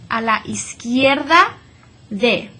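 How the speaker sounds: "izquierda" as iskierda, u silent; model Spanish. Shown as a demonstration. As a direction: say "a la izquierda de" without the u. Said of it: "I just went right over that U".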